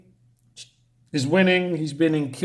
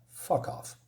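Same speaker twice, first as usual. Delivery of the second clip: a whispered, much softer curse; whispered aside; 12 dB quieter than usual. Real, not acted. Reviewer dismissing the book's joke, whispered aside. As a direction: hiss it under your breath.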